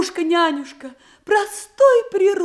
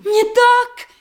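eager protest; 10 semitones higher, 6 dB louder than usual. Acted. Protesting denial.

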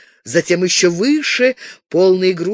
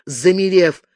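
plain reading voice

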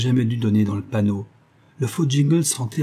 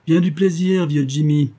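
higher, louder mocking farewell; taunting, raised-pitch send-off; sneering; 6 semitones higher, 4 dB louder than usual. A narrator's reading. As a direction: call out a gleefully derisive goodbye. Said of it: "il s'en alla en ricanant".

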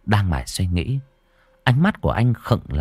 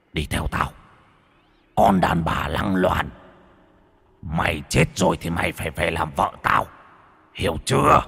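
spiteful ghost voice; 5 semitones lower than usual. A narrator's reading. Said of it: "giọng hằn học".

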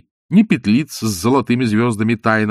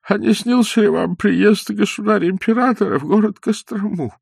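put-on eager old man's question; 11 semitones higher than usual. Old man eagerly asking.

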